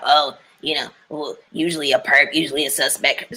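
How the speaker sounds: lightly voiced, low mock cop; a hint of a mocked know-it-all cop; mocking detective.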